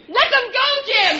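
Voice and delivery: shouting the go signal from the lookout; urgent shout. Acted. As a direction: yell the signal now. Signalling to release the logs.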